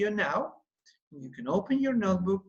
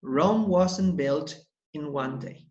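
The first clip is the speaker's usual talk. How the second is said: slow proverb; 3 semitones lower than usual. Shown as a demonstration. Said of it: "As we say in English".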